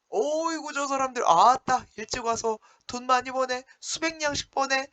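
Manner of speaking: envious marvel. Retold or imitated. Teacher voicing Heungbo envying the paid convicts, high marveling voice. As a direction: gasp in envy at their earnings. Heungbo envies the beaten men.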